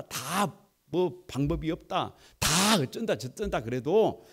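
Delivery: ordinary talk, nothing marked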